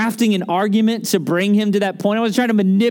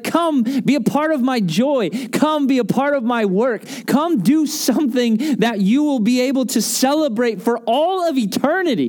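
raised, ringing appeal; fervent invitation; 5 semitones higher than usual. Acted. Preacher voicing God inviting people into his work, fervent. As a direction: invite them eagerly, pitch lifted throughout.

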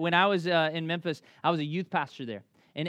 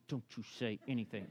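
put-on much softer, urgent hush to the kid; hushed warning; 6 semitones lower, 12 dB quieter, faster than usual. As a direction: warn him under your breath. Silent warning beside the shark.

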